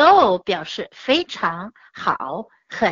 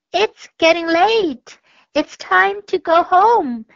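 higher, brighter little-mouse voice, a bit louder; little mice voice; 7 semitones higher, 4 dB louder than usual. A narrator's reading. Mice telling the cats it's time to go, little mouse voice. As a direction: squeak it out like a tiny mouse. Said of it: "said the little mice".

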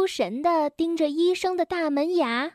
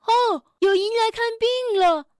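cheerful, higher beaver doctor voice; glad doctor; 7 semitones higher, 3 dB louder than usual. A narrator's reading. The dentist happily greets his new patients, cheerful. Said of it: "牙医高兴地迎了上来".

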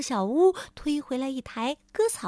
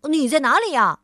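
louder quick call up the house; busy calling; 3 semitones higher, 6 dB louder, faster than usual. Acted. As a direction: call out eagerly. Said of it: "他问道".

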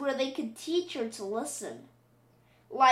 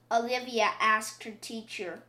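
ordinary delivery, nothing marked

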